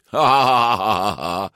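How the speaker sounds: long drawn-out jeering laugh; performed taunting cackle; mocking laugh. A narrator's reading. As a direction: laugh in the rider's face.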